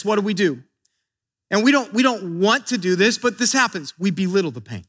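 normal talking voice

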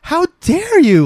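high, loud, drawn-out indignation; heated. Retold or imitated. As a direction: act out her outrage, slow and loud. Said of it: "getting all heated at him".

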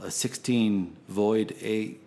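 ordinary talk

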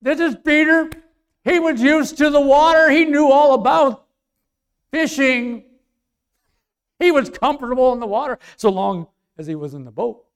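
loud, high pulpit delivery; full-force preaching. Acted. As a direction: preach it out to the whole church.

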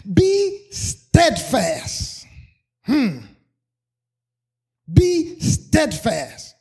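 loud, high, stretched preaching of the verse; preached scripture reading. Acted. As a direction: proclaim each word to the church.

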